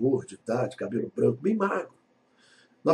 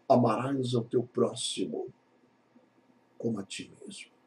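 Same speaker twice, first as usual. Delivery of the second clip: quiet, reverent reading of amarás ao teu próximo; hushed scripture line; soft solemn quote; 3 semitones lower, 6 dB quieter than usual. Acted. Jesus' words quoted softly.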